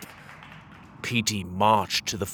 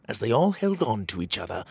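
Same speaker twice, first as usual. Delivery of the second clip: ordinary delivery, nothing marked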